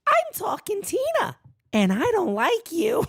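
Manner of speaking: high, eerie doll voice, starting up high; mock Talking Tina doll threat; creepy doll voice. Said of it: "sounds like Talking Tina from the Twilight Zone".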